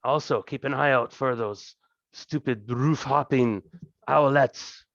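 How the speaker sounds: boisterous lord, lightly voiced, slow in places; a hint of a greasy, jovial thug king; fat crime king. Thieves' king welcoming the party to his throne room, lightly voiced and jovial.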